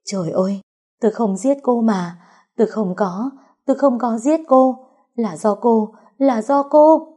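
high, desperate protest of innocence; wailing cry. A narrator's reading. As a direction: cry out in panic, climbing to a loud accusing shout. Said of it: "tiếng khóc kêu gào của Vũ Huệ".

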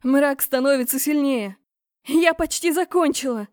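loud, high urgent cry; shouting back. A narrator's reading. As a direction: shout over the shoulder under strain. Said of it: "крикнула через плечо". (крик через плечо, громко и высоко)

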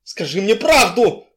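loud, high-pitched angry demand; yelling. A narrator's reading. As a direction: demand it at the top of your voice. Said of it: "заорал я".

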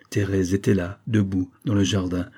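plain reading voice

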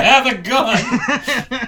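high, drawn-out mock yell through laughter; mock shout. Friend jokingly yelling a threat at the postal clerk, laughing mock shout. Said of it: "You should just be like".

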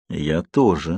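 low, soft, mild gloating; a touch of malicious relish. A narrator's reading. Emmett looking forward to the fight, gloating. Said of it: "злорадствовал Эммет".

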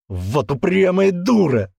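slow, high, loud exasperation; frustrated anger. Acted. Man slamming the wall at her, frustrated anger. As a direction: curse her stubbornness, drawn out.

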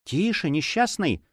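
horrified hush. Acted. Girl hushing her lover in terror, slow high cry. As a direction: stop him before they are heard. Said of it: "вскричала она с ужасом".

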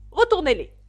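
loud, high-pitched command; angry order. A narrator's reading. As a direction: bellow an order at a servant. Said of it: "avec colère et dit au valet". (ordre crié avec colère)

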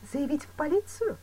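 high-pitched, doubtful question; questioning echo. A narrator's reading. Woman questioning advice to report him, surprised.

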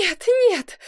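high, tearful wail; crying, acted. A narrator's reading. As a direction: wail it in theatrical despair. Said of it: "плакала". (плача, высоким голосом)

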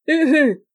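loud, high hooting cackle; Jean-Marie's sniggering laugh. A narrator's reading. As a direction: cackle "hu-hu-hu" like a delirious drunk. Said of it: "ricanait le roi de la mer".

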